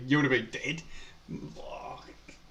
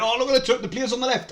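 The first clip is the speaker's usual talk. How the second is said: much higher, louder over-excited commentator; exaggerated commentator; 11 semitones higher, 6 dB louder than usual. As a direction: ham up a pundit getting carried away. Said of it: "they used to over-exaggerate".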